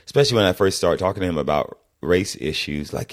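ordinary talk

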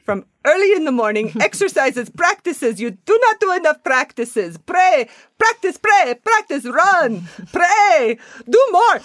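builds to high, loud commands; hammed-up boot-camp guru in put-on accent; demanding spiritual drill leader. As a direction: bark mock orders, rising in pitch and force. Parodies drill leader in group accent.

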